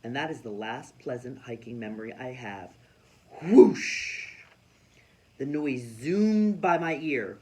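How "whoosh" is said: loud airy whoosh; a bird rushing past the ear; whoosh sound. A narrator's reading. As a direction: blow the whoosh like wind. Sound effect of bird zooming.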